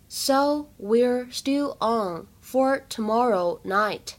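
each word spoken slowly; slow practice read. Shown as a demonstration. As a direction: read it slowly for learners to follow. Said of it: "在这句话朗读过程当中呢". (慢速示范朗读)

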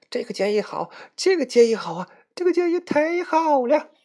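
越说越激动的叫好; excited agreement. Acted. Queen and Xiaolan agree loudly. (高声连声叫好)

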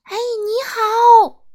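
loud, high, drawn-out shout; yelled greeting. A narrator's reading. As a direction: bellow hello across the street. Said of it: "会这样大叫". (拖长声音高声大叫)

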